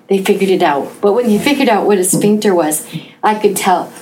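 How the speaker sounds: ordinary talk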